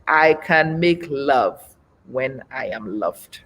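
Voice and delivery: slow, each word weighed, softening at the end; slow and deliberate. Real, not acted. Slow, emphatic repeat for live viewers to digest. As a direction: let it sink in slowly. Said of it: "digest it for a second".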